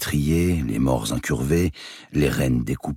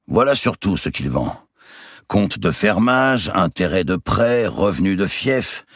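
booming giant; 6 semitones higher than usual. Acted. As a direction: play a swaggering giant of a nobleman. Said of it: "dit le géant".

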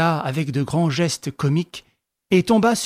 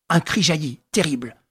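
ordinary delivery, nothing marked

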